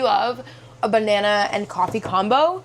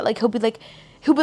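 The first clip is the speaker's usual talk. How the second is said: usual speaking voice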